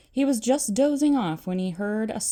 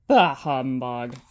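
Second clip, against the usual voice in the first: grumpy scoff; 8 semitones lower, 5 dB louder than usual. Acted. Scrooge dismisses spirits.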